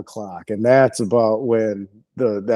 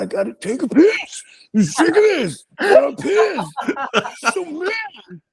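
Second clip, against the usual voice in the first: very high, strained mock wail; hammed-up high-pitched tantrum; desperate drunk whine; slower than usual. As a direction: squeal it like a drunk bursting to pee. Drunk friend desperate to pee.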